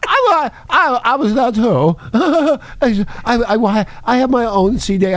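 high, hammed-up put-on voice; Prince Andrew. Host playing a royal on the phone, high mock impression. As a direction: stammer it out high, with a giggle. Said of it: "Prince Andrew, hello!".

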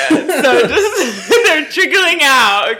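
giggling through the words; laughing. Real, not acted. Laughing mid-brag about photos.

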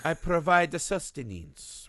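slow, lightly put-on Italian accent; proud Italian kitty, lightly voiced; a hint of Italian accent, slow and proud; Italian cat. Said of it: "the cat's like an Italian".

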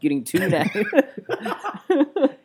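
laughing, drawn out. Real, not acted. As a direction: stretch it out through laughter. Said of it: "repeats phrase laughing".

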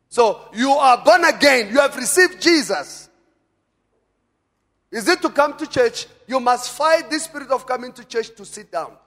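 loud, high-pitched sermon delivery; rousing pulpit exhortation; forceful preaching. Real, not acted. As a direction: preach it with full conviction.

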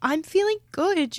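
high, bright and excited. Real, not acted. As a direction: lift it up with excitement. Repeats with more energy.

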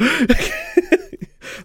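high delighted shriek of laughter. Real, not acted. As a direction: burst into a squealing laugh. Delighted at coincidence.

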